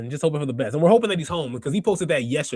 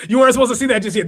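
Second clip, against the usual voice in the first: louder, fast, laughing through it; 6 semitones higher, 7 dB louder, much faster than usual. Real, not acted. Streamer caught playing the next segment early, laughing. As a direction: laugh at your own slip.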